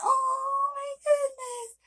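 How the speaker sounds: high, slow, tearful oh my goodness; crying with joy. Retold or imitated. Seeing the new puppy on her lap, in slow high tearful joy. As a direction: break into happy tears. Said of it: "And I'm crying".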